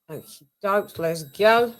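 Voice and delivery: usual speaking voice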